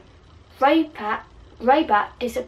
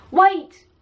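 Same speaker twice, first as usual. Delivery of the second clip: sharp high shout of wait; loud cry to halt everyone; 8 semitones higher, 6 dB louder than usual. Acted. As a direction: call out to stop her. Ron shouts to Bellatrix.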